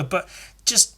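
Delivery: ordinary talk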